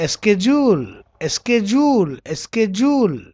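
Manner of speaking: SCHEDULE as "es-KE-jool"; American pronunciation. Shown as a demonstration. Teacher drilling American schedule for students, "ske-jool". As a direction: hit the sk and j in SCHEDULE. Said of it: "In American".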